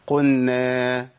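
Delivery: "قلنا" as "qunna", the l lost into a long n; slow chant "قلنا" with doubled n, no l; merged lam. Shown as a demonstration. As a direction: swallow the lam of "قلنا" into the nun. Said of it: "طب الخطأ فين يا عم الشيخ؟".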